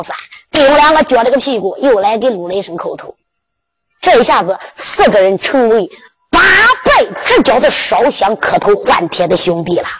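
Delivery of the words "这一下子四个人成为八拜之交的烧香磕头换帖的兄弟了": ordinary delivery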